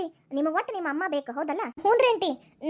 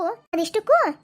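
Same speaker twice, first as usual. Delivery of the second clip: louder, excited echo; raised voice, all eagerness; eager echo; 3 semitones higher, 6 dB louder, much slower than usual. Acted. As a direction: jump on the word eagerly. Eager echo at mention of property.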